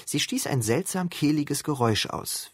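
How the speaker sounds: usual speaking voice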